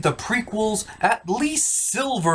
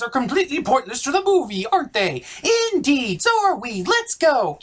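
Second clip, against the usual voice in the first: eager rebel, pitched well up; cheerful rebel rallying the others; high rebel voice; 7 semitones higher, faster than usual. Acted. Rebel characters voiced in parody.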